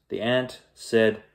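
ordinary delivery, nothing marked

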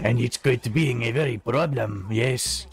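badly faked French spy voice; bad French accent. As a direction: put on a hammy, garbled French accent. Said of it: "I can't do a French".

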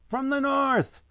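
high-pitched jeering call; mocking shout. A narrator's reading. Islander mocks Lucas from the rooftops, high shout. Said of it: "someone shouted".

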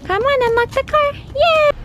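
rising into a loud, high yay; excited cheer. Real, not acted. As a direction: cheer him on and burst into a yay. Cheering toddler to unlock car.

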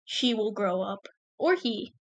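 usual speaking voice

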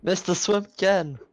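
high loud frustrated yell; exasperated shout. Real, not acted. Exasperated callout.

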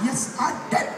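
soft, nearly whispered admission; hushed confession. Acted. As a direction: confess it under your breath. Acts his reply to interrogators.

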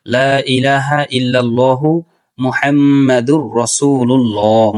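slow, drawn-out Arabic recitation. Shown as a demonstration. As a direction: recite the kalima slowly for learners. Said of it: "আমি আবার বলে দিচ্ছি". (ধীরে ধীরে কালিমা তিলাওয়াত)

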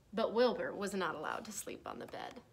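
usual speaking voice

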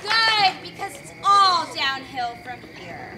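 announcer. Acted. Addressing audience at intermission.

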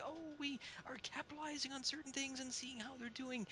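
normal talking voice

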